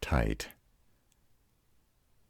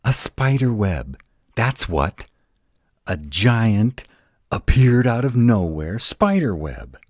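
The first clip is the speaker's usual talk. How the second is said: louder and higher, emphatic; exasperated insistence; 7 semitones higher, 8 dB louder than usual. Acted. To doubting partner, loud exasperated insistence. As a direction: hammer home that it was real.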